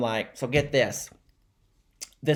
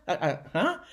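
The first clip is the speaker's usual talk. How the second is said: high, halting, puzzled noises; acted confusion; 6 semitones higher than usual. [name]'s son told he's uncool, stammering in confusion. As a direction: stammer upward, lost for words.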